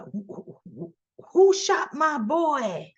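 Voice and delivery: stammer, then demand it slowly; stammered anguish. Retold or imitated. Anguished father asks who shot son.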